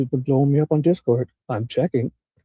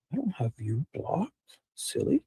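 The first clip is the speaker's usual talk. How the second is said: ordinary talk, nothing marked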